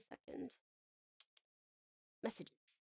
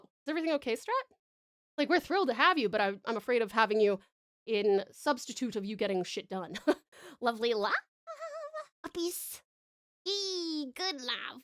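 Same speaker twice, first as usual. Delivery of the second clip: ordinary talk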